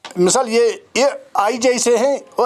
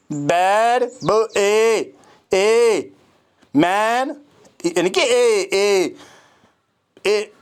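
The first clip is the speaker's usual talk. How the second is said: usual speaking voice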